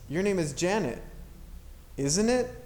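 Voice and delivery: "isn't it" sweeps up high at the end; rising tag. Shown as a demonstration. Teacher showing the checking tag, rising inflection. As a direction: make "isn't it" a true question, rising. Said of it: "that rising inflection".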